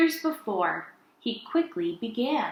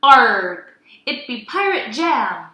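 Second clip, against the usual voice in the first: gruff hammed-up pirate growl; 8 dB louder than usual. Fredbeard announcing his pirate jam, loud pirate voice. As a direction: bark it like a proud pirate. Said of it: "he said".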